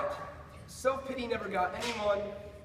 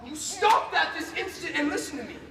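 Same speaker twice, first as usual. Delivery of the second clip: loud, sharp command; stern scolding; 4 semitones higher, 6 dB louder than usual. Acted. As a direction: cut them off and demand attention. Scolds his unruly colleague or creature.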